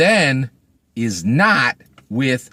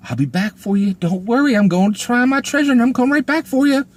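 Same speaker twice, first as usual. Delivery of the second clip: higher, quick, airy promise; mocking the boy; 9 semitones higher than usual. Reviewer mocking the boy promising Fatima he'll return, high and fast. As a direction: ham up the hero's hollow promise, rushing it. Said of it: "and he tells her".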